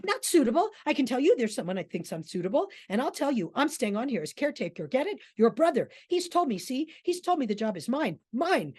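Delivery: loud, quick, affronted insistence; indignant protest. Acted. Davies insists to Aston the job is his, indignant. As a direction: bristle and insist, punching out the claims.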